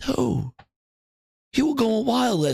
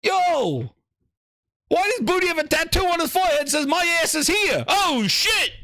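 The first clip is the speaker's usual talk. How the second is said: shocked shouting; 5 semitones higher than usual. Acted. Shocked discovery, calling out.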